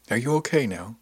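plain reading voice

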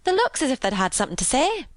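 high, quick Yorkshire maid voice; quick, high-pitched servant girl. A narrator's reading. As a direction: say it briskly in high northern dialect. Narrator voices maid's dialect.

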